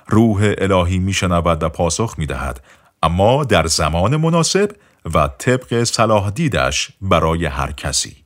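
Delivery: plain reading voice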